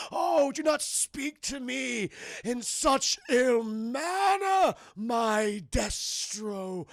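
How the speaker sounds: slow, high, loud theatrical villain proclamation; drawn-out shouted villain voice; hammy villain. Retold or imitated. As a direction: proclaim it like a cartoon overlord. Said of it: "And he goes".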